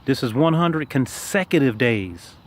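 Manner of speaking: slow, with weight on "consecutive days"; emphasis on consecutive. Shown as a demonstration. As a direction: lean on "one hundred consecutive days", drawn out. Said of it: "Let me emphasize".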